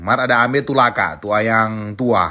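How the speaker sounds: ordinary delivery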